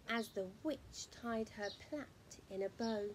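ordinary delivery, nothing marked

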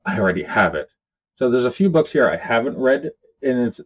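ordinary talk

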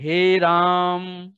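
हे राम with the final vowel held very long and slow; drawn-out vowel. Shown as a demonstration. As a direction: stretch राम out like a call, slowly. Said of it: "जैसे हम बोलते हैं".

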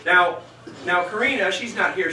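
normal talking voice

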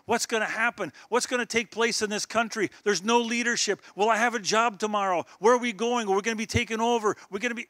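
high, loud anxious questions; acted alarm. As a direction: fire off worried questions like a panicking crowd. Voices a worried people's questions.

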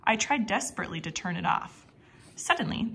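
ordinary delivery, nothing marked

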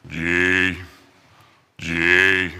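low croaky frog voice; low frog croak; croaking rock. Acted.

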